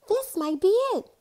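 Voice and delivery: high, eager call; a mild excited shout. Acted. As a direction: call it out, thrilled the test might work. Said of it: "Donna shouted".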